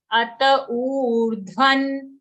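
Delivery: slow, drawn-out "urdhvan"; demonstrated pronunciation. Shown as a demonstration. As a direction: stretch the long u in "urdhvan", then land on the n. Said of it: "So we say urdhvan".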